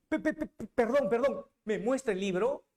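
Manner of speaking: high, stammering start, then pleading; stunned stammer. Acted. Quijote stammering at the neighbour over the book, stunned. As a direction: trip over the first syllable in shock.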